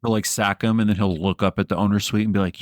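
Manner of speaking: ordinary talk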